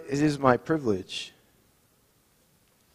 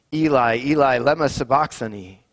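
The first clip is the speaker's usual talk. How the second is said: raised, anguished cry of Eli, Eli; cried out loud; 3 semitones higher, 8 dB louder than usual. Acted. Jesus crying out from the cross, raised voice. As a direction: call it out in anguish, a little louder. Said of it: "Jesus cried out in a loud voice".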